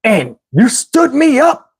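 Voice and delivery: slow, high mock scolding; imitating an irate woman, word by word; going off. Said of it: "she starts going off on me".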